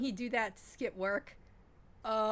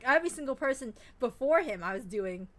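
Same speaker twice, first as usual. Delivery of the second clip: usual speaking voice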